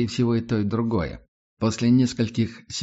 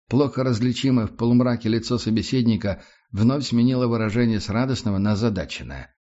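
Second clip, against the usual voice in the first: usual speaking voice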